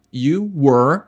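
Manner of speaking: You were at home bem lento; devagar. Shown as a demonstration. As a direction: articule pausado para o aluno. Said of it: "Como eu digo, você estava em casa". (You were at home, lento, para repetir)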